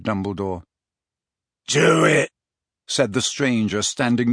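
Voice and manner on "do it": harsh command. Acted. Death Eater urging the kill.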